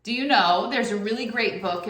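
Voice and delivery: ordinary talk, nothing marked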